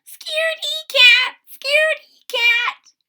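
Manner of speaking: very high, loud squeaking taunt; squeaky mice. A narrator's reading. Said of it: "they squeak at Sarah".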